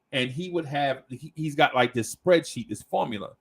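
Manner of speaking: normal talking voice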